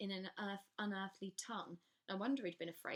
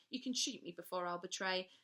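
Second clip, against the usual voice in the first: defiant; 5 dB louder than usual. Acted. Maya defies the gun.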